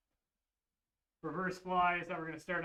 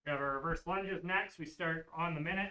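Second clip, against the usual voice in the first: ordinary talk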